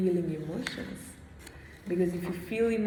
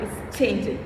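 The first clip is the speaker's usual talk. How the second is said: ordinary talk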